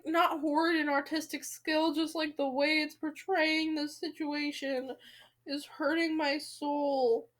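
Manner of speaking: usual speaking voice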